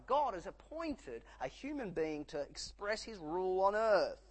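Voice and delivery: ordinary talk, nothing marked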